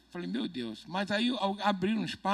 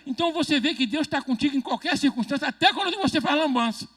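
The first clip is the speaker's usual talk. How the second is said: mais alto, pregando com força; pregação inflamada; 6 semitones higher, 7 dB louder than usual. Acted. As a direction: levante a voz como pregador. Preaching to congregation. (pregador exaltado para a igreja)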